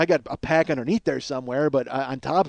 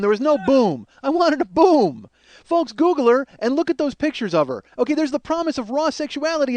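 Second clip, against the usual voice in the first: rising rant; 10 semitones higher than usual. Real, not acted. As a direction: whip the rant up to a peak. Rant peaks about no boom.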